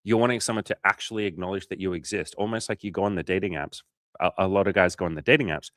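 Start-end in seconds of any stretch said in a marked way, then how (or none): none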